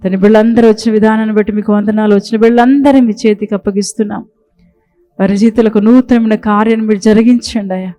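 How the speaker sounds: solemn prayer to the Father. Real, not acted. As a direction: pray aloud in thanks to God. Leads closing prayer.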